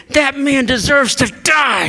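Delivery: high-pitched outburst of anger; indignant acted shout; angry. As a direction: pronounce judgment in outrage. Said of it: "He's so angry".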